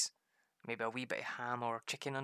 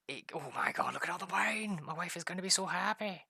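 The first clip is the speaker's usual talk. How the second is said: higher, louder, thrilled shout; excited exclamation; 7 semitones higher, 4 dB louder than usual. Real, not acted. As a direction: burst out over the loot. Finds wine, imagines wife.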